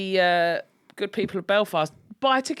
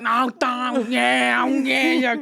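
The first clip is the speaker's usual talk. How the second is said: Essex voice; 5 semitones higher, 4 dB louder, much slower than usual. Retold or imitated. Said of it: "when you sort of Essex go".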